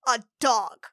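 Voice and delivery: ordinary talk, nothing marked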